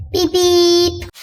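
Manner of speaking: high, loud honk mimicked; car horn beep. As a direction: toot like a taxi horn. Taxi horn sound.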